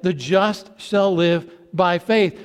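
slow, with "faith" weighted at the end of "The just shall live by faith."; stress on faith. Shown as a demonstration. Preacher quoting Hebrews to the congregation, stress on "faith". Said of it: "emphasizes the faith part of that".

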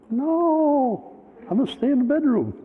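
very high-pitched, hammed-up refusal; mock reluctant refusal. Pastor hiding from his visitor, mock high-pitched protest. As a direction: squeal the refusal in a comic high voice.

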